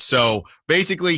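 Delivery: ordinary talk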